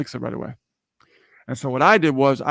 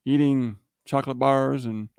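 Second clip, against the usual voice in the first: ordinary talk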